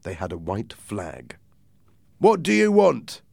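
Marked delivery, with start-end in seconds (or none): 2.2-3.2 stern challenge. A narrator's reading. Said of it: "said Captain Smollett".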